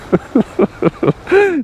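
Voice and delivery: high-pitched chuckle; laughing. Real, not acted. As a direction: laugh off the very idea. Laughs off trying a Welsh accent.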